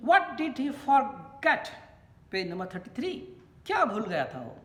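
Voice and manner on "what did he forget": dictation reading. Shown as a demonstration. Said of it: "Next question".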